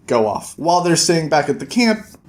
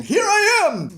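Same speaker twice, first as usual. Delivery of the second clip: mock call out; slower than usual. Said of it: "might as well just be saying".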